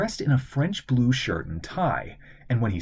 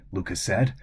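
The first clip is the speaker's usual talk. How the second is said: usual speaking voice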